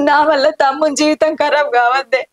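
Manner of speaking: ordinary talk, nothing marked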